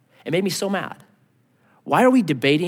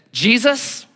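puffed-up Pharisee; 4 semitones higher than usual. Pretend. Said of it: "puffed up and says".

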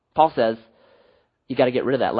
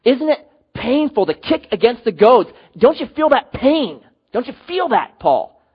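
higher-pitched, insistent pleading questions; Jesus; 8 semitones higher, 4 dB louder than usual. Acted. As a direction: press the question home, pitch lifted high. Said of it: "Jesus would say to Paul".

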